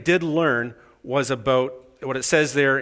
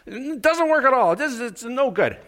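squeaky insistence; 12 semitones higher than usual. Retold or imitated. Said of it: "Guy said".

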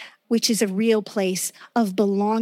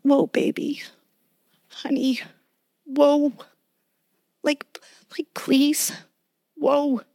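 tender plea; 4 semitones higher than usual. Pretend. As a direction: gently hold back a child you love. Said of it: "this woe, I think, is more like a".

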